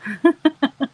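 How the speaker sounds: a sudden loud burst of laughter. Real, not acted. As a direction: let out a big real laugh. Laughing at viewers' comment.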